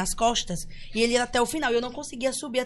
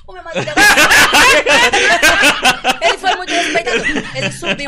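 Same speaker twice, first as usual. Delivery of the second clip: higher, louder, through laughter; laughing; 3 semitones higher, 11 dB louder than usual. Real, not acted. Wife laughs while defending her husband's manners. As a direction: laugh while praising him.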